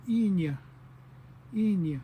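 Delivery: "inya" starting straight on the vowel, falling; h dropped. Shown as a demonstration. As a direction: demonstrate "inya" with no h at the front. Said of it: "take away that "he nya".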